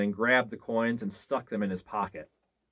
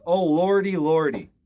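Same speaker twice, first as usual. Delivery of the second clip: higher, loud frightened moan; terrified cry; 6 semitones higher, 7 dB louder than usual. A narrator's reading. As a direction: quake with fear as you say it. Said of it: "the gravedigger moaned".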